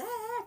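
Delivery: short, high-pitched mock scream; grito de endemoniado. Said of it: "se pone a gritar como locos". (grito fingido de loco, agudo)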